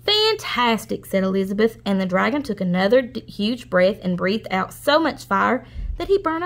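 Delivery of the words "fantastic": high, slow, drawn-out praise; flattering praise. Acted. Princess praising the dragon's fire, high slow gushing. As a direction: gush over him slowly.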